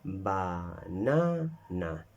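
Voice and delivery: banana said very slowly, weight on na; stress on baNAna. Shown as a demonstration. Teacher shows the class banana, slow with stress on na. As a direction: stretch out banana, hitting na. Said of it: "So we can identify".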